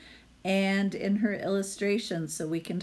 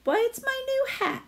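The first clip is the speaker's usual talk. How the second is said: much higher, quick, proud little hedgehog voice. Acted. Hedgie shows off his new hat to the hen, high and proud. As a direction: chirp it like a pleased little hedgehog.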